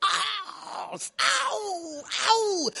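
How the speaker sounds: high, drawn-out "ow" of pain; imitated labour moans. Husband mimics wife in travail, pained moaning. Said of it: "the countenance begins to change".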